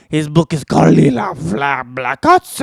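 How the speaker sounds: ordinary delivery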